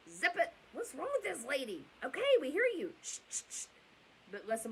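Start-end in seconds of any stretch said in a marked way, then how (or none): 0.1-2.9 louder, exasperated brush-off; annoyed disciples. Retold or imitated. Disciples telling the woman off, exasperated. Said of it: "they're basically saying". 3.0-3.6 whispered shh; mock shushing. Disciples hushing the shouting woman, whispered. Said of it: "they're basically saying".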